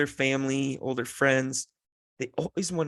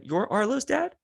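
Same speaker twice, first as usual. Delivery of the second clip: put-on high, curious little-kid question; toddler voice; 10 semitones higher than usual. Toddler questions Arlo's dad, high childlike voice. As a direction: imitate a small child checking who you are. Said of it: "two and a half year old kid".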